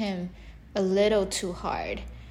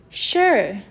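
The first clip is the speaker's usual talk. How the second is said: a quick hissed sure, a touch louder; hissing snake; 2 semitones higher, 6 dB louder than usual. A narrator's reading. Snake agreeing to play with Frog, hissing. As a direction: lightly hiss it like a snake. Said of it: "hissed Snake".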